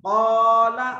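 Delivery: क़ाला with a loud, held 'qaw'; thick alif. Shown as a demonstration. Teacher giving a thick-alif example, क़ाला with long heavy 'qaw'. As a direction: round and stretch the first vowel of क़ाला. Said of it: "मोटा पढ़ेंगे".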